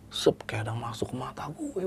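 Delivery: soft unvoiced eerie whisper. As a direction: hush it, something creeping in. Spirit entering his eyes. (berbisik seram)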